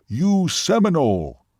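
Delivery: slow, high, emphatic declaration. A narrator's reading. Seminole tells waitress what she is, emphatic. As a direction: pronounce it slowly and with force. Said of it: "he said emphatically".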